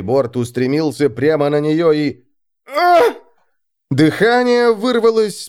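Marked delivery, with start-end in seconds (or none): 2.7-3.0 sharp, high cry of impact; pained cry. Acted. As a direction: cry out as you hit. Alex hits the ship's side.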